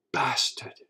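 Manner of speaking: whispered curse; unvoiced, seething aside as the wife; wife's outraged whisper. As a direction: whisper it in acted indignation. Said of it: "your wife is like".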